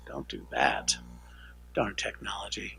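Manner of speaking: low, very soft self-talk; grumbling aside. Real, not acted. Presenter fighting with the slides, muttered grumble. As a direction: grumble under your breath at the equipment.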